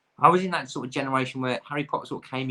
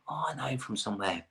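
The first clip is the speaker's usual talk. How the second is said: softer, musing recognition; mock viewer aside; 3 semitones higher, 7 dB quieter than usual. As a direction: play a viewer quietly recognising an actor. Acting out a TV viewer.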